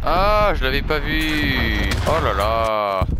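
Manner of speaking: high, loud, drawn-out gasp; surprised. Real, not acted. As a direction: caught off guard, stretching it out. Sudden surprise in game.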